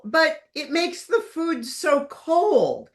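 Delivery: hammed-up aristocratic lament; lightly mocking complaint; mock wail. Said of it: "She used to wail".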